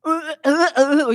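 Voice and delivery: strained, halting "uh, uh" grunts; vowelless reading demo. Shown as a demonstration. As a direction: demonstrate unreadable consonants as stuck grunts. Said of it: "이렇게 읽으셔야 되잖아".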